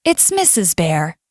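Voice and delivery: plain reading voice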